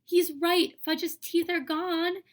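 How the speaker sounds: high, loud alarmed voice; horrified. A narrator's reading. Girl realizing the toddler lost his teeth, alarmed. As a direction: gasp it out in dismay.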